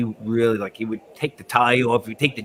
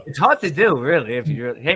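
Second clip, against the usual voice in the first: usual speaking voice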